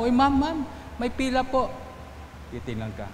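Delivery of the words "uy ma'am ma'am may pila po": high, polite calling out. Retold or imitated. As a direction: reach a higher voice to call the lady back. Calling out to woman cutting line. (mataas at magalang na pagtawag)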